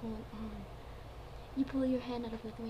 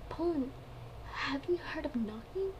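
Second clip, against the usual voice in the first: hammy stage actor; 4 semitones higher than usual. Acted. Lithuania scolds Poland.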